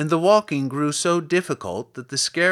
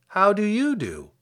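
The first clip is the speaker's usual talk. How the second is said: put-on higher, polite girl's return greeting; 7 semitones higher than usual. A narrator's reading. Girl returns the Scarecrow's greeting, politely. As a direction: return the courtesy with a lifted pitch. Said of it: "replied Dorothy politely".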